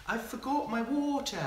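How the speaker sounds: high-pitched imitation of a wee girl; wee English girl. Coach imitating a kid he taught, wee English girl voice. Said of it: "one of the wee girls would be like".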